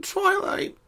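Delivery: high small voice, held-back sob; lightly voiced pony holding back a sob; hushed mutter. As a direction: whisper her name through tears. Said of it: "muttered in a hushed breath".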